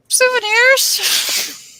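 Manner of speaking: very high, drawn-out innocent voice; fake innocent. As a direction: sing it out all innocent. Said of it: "we're like".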